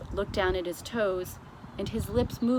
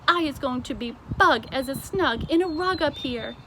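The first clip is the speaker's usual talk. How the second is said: BFG delighted cry; 6 semitones higher, 5 dB louder than usual. A narrator's reading. The giant admires his piano seat, delighted and loud. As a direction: savour the made-up words like a thrilled giant. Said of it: "cried the B F G".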